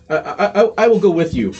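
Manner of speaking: loud, high, stumbling reply; stammered agreement. Acted. Yellorin agrees to come along, loud stammered agreement.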